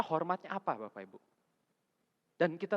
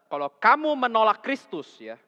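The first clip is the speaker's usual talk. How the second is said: louder, higher, drawn out warning; slow, loud preaching; 7 semitones higher, 12 dB louder, slower than usual. Acted. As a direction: warn the congregation slowly. (peringatan khotbah lambat dan keras)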